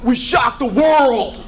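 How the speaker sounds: high, drawn-out victory shout; mock athlete's boastful yell, slow and high; triumphant athlete. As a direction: crow it like an upset winner. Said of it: "I like when athletes say".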